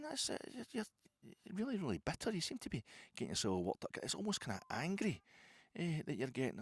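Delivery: squeaky high spider voice, cocky; talking spider. Pretend. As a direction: play the spider sneering at the big man. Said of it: "he says".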